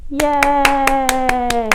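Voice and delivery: long drawn-out cheer. Pretend. Reader celebrates the story's end, cheering. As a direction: hold the cheer on one note.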